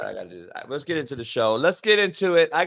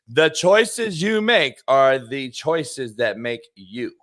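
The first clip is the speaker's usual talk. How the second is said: slow weighty reading of the quote; slow, deliberate quote; 2 semitones lower, slower than usual. Shown as a demonstration. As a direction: lean on each word like a motto. Quote of the day, slow reread.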